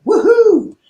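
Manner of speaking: high whooping party cheer; wild cheer. Retold or imitated. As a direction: whoop like careless youth. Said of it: "they're just going at it".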